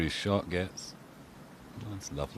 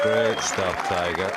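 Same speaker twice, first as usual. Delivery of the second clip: excited; 8 dB louder, slower than usual. Real, not acted. Repeated praise after shot.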